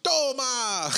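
shouted catchphrase. Retold or imitated. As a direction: yell it like a hype man.

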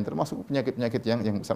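ordinary delivery

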